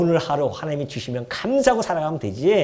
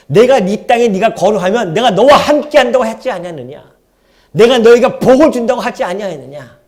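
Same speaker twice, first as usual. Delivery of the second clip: louder, higher, emphatic divine voice; booming God; 4 semitones higher, 9 dB louder than usual. Acted. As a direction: proclaim it as God speaking. God's voice speaking to Isaac. (이삭에게 말씀하시는 하나님의 우렁찬 음성)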